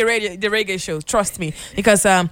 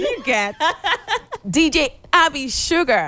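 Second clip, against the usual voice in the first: higher, laughing through the name; 8 semitones higher than usual. Real, not acted. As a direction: giggle while saying the DJ name. Repeats her DJ name laughing.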